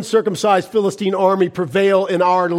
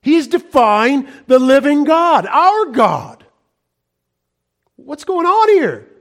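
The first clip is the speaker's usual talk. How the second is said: loud, higher, slow indignant call; indignant outcry; 7 semitones higher, 4 dB louder, slower than usual. Acted. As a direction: cry out in outrage at the cowardly army. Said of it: "David said".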